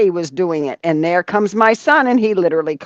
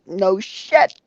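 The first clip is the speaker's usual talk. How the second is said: ordinary talk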